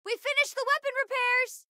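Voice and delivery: high, called-out update; excited high-pitched announcement; urgent report. Acted. Calling out a repair report.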